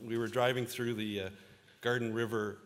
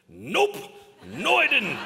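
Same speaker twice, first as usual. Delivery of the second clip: mock denial; 12 semitones higher, 12 dB louder, slower than usual. Boss jokingly answers.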